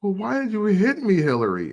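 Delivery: put-on high, whiny mean girl voice; mock whiny mean girl. [name] as mean girl asking why she got hit, high and whiny. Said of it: "And then it's like".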